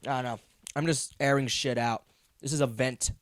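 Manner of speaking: ordinary talk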